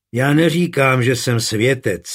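usual speaking voice

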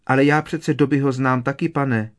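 high squeaky house-elf voice; elf squeak. A narrator's reading. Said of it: "zapištěla skřítka".

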